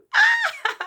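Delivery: high-pitched loud cackle; amused shriek of laughter. Real, not acted. Amused by the full names.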